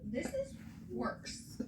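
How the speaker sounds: slow, whispered and high; disgusted. Real, not acted. Host comparing the two scandals, in a slow high whisper. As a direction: drag it out in a breathy whisper. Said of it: "And I thought Gino ... was bad".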